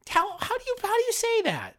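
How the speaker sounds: very high, exaggerated puzzled teacher; teacher stopping class to ask how the name is said; mock teacher. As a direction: overplay a teacher's disbelief. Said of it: "And they go".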